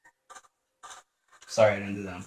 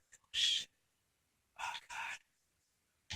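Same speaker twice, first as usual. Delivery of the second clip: whisper; 14 dB quieter, much slower than usual. Real, not acted. Scared in a horror game.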